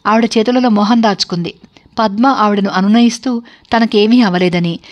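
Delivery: ordinary delivery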